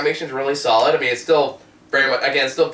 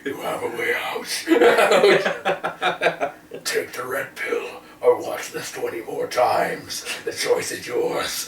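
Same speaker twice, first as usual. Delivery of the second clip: Morpheus voice; 3 dB quieter, slower than usual. Acted. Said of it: "My one-man show".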